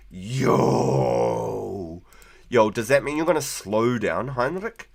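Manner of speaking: ordinary delivery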